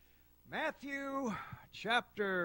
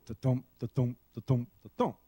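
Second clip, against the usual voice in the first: rhythmic heartbeat sound, ta-thump spoken like a drum; preacher imitating a normal heartbeat for the congregation; imitated heartbeat; 6 semitones lower than usual. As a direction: tap out a steady heartbeat with the voice. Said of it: "it goes".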